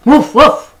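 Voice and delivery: very high, loud barks; dog bark. Acted. The dog calls to the spider, loud high barking. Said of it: "barked the dog".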